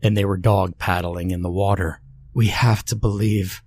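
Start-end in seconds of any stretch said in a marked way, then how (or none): 2.3-3.6 teeth chattering with cold; shivering words through chattering teeth. A narrator's reading. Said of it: "Mira said through chattering teeth".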